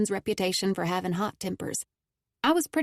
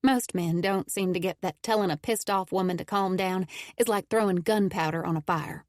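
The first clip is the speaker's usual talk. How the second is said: usual speaking voice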